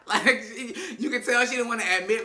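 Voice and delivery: talking through real laughter; laughing. Real, not acted. Recapper cracks up describing Joy, talking through real laughter. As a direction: laugh while you explain.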